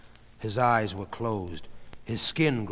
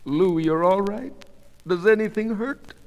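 high, pleading questions; anxious wife; 9 semitones higher, 4 dB louder than usual. A narrator's reading. Goldie crawling to her sick husband, anxious. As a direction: ask worriedly in a raised pitch.

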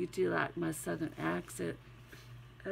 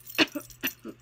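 real cough; 6 dB louder than usual. Real, not acted. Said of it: "Oh, I'm so sorry".

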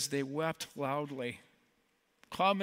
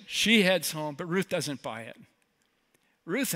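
ordinary talk, nothing marked

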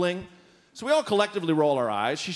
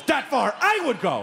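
higher, punched-up quote; emphatic; 5 semitones higher than usual. Retold or imitated. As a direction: imitate an emphatic cab driver. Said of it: "That's emphatic".

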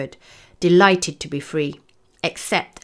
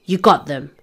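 mildly delighted cheer, a bit louder; a touch of triumph, louder; delighted roar; 5 dB louder than usual. A narrator's reading. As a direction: exclaim it with understated delight. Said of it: "we roared delightedly".

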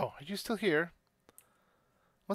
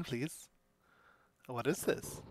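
normal talking voice

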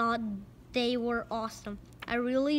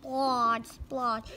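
ordinary delivery, nothing marked

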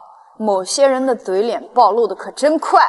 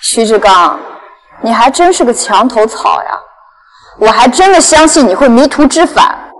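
angry shout; 6 dB louder than usual. Acted. Heroine shouts at traitor.

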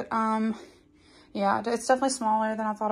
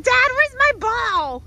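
high whiny pleading voice; dog's voice; 12 semitones higher, 5 dB louder, slower than usual. Retold or imitated. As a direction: voice the dog asking for its ball. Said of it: "Sophie's like".

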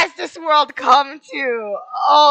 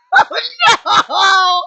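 normal talking voice

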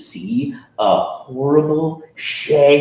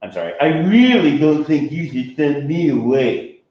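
mild warning; 5 semitones higher, 3 dB louder than usual. Acted. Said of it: "he warned".

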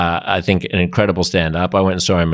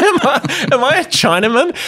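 high, amused mock wondering; Michael Hing. Retelling a comic asking himself about his identity, mock pensive. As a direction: question yourself in a raised voice, on the edge of laughter. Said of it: "asking himself pensively".

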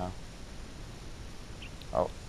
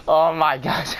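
laughing; slower than usual. Real, not acted. Laughs at own failure.

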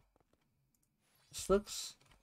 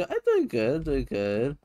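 ordinary talk

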